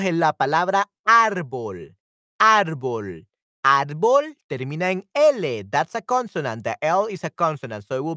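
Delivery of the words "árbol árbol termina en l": ordinary talk, nothing marked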